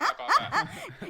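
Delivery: laughing posh British put-on; British accent. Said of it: "And I'll do British accent".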